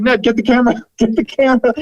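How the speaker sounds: high, laughing rush to the cameraman; host telling Ned to grab the camera, excited and laughing; excited urgent call. Retold or imitated. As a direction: grab the moment, breathless with glee.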